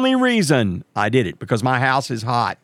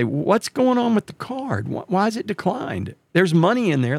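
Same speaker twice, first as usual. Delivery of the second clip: indignant question; 8 semitones higher than usual. Retold or imitated. Confronting the bank teller.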